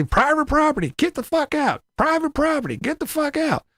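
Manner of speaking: high, sing-song chant of a warning sign; mock sign voice. Mocking the neighbor's sign in a high sing-song chant. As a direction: chant it like a cartoon keep-out sign.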